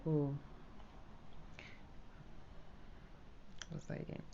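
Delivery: barely voiced, bored mumble. Shown as a demonstration. A teacher mocking a bored candidate, near whispered. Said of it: "You cannot be like".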